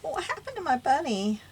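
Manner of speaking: high plaintive wail; dismayed lament. Real, not acted. Crafter to herself about her missing bunny, dismayed. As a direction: cry it out high, bewildered.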